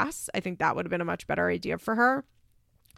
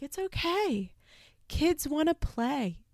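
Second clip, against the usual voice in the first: a light mock Eastern European accent, higher and slow; soft accented imitation; 7 semitones higher, 3 dB quieter, slower than usual. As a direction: gently soothe the parent in a hint of an unplaceable accent. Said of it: "it almost sounded Eastern European".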